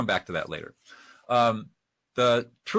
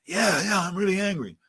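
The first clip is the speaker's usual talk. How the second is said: high, worked-up agreement; acted angry yelling; 8 semitones higher than usual. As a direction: play someone still heated and venting. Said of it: "Somebody is yelling".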